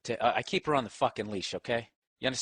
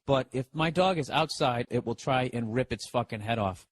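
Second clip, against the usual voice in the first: ordinary talk, nothing marked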